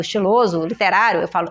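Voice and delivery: usual speaking voice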